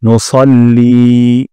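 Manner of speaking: "নুসাল্লি" said as সল্লি with লি drawn out; slow, round the স and lengthen লি; heavy sa with long li. Shown as a demonstration. Teacher correcting the সাল্লি mistake. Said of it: "সাল্লির জায়গায় বলবো সল্লি".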